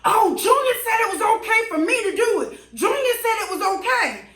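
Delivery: high mock voice of the excuse-making neighbor; mimicking neighbor. Neighbor at the door, lightly mocked high voice. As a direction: ham up his lame excuse. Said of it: "by the time he came to the door".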